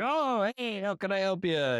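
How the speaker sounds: butter up a customer walking into your shop; fake friendly shopkeeper. Podcaster imitating Watto greeting customers, fake friendly. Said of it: "he's like".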